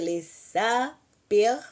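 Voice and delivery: le saper drawn out slowly, syllable by syllable; slow Hebrew demo. Shown as a demonstration. Teacher models le saper slowly for students. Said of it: "é assim que você pronuncia".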